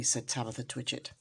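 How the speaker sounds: ordinary delivery, nothing marked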